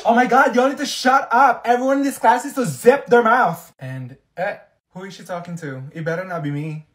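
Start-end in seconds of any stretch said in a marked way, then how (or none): 0.1-3.7 high, loud outburst; fed-up scolding. Acted. Student telling class to shut up, angry scolding. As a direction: yell at the room to hush.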